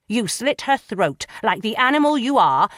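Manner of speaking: loud, raging accusation; furious accusation. Acted. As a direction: hurl it at him like an insult. Magistrate raging at prisoner.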